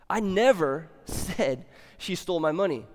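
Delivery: "never" punched loud, then a pause; stress on NEVER. Shown as a demonstration. Preacher showing a second meaning, stress on "never". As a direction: lean hard on "never" and let it hang. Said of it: "depending on which word you emphasize".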